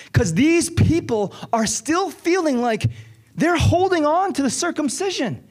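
high, driving sermon voice; pleading with the congregation, urgent preaching; impassioned preaching. Acted. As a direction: preach it with rising fire. Preaching builds to full force.

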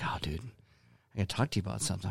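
quoting a friend softly and seriously; a low, hesitant man-to-man phone opener; mock-serious hushed buddy voice; hushed imitated phone call.

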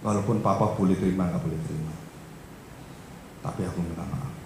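put-on hushed, low child's apology; retold humble apology. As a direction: confess softly to a father. Retelling child's apology to father.

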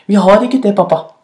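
a hint of a small hyena child; lightly voiced child. A narrator's reading. Little hyena answering papa, lightly childlike.

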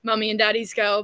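normal talking voice

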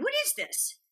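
high mock puzzled boyfriend. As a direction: squeak it out, baffled by the script. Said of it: "he's like".

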